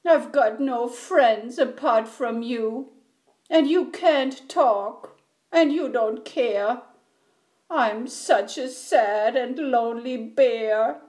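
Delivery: wailing, tearful storybook bear; whimpering. A narrator's reading. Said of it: "he whimpered".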